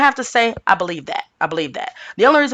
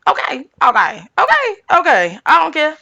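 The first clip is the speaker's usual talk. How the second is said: rising, higher and louder sassy okays; defiant okay; 9 semitones higher, 3 dB louder than usual. Real, not acted. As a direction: brush the haters off with attitude. Defiant about how she talks.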